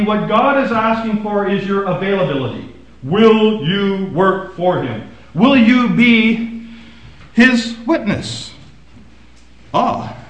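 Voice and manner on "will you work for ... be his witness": each word drawn out very slowly; slow pleading challenge. Acted. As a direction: lean on every word of the question. Preacher's challenge to congregation.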